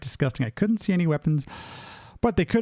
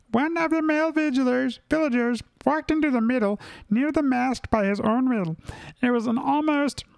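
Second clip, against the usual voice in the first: much higher-pitched storytelling voice; pitched-up, put-on reading; high put-on narrator voice; 10 semitones higher than usual. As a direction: read the journal in a sustained high voice.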